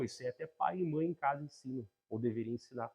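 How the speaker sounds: ordinary talk, nothing marked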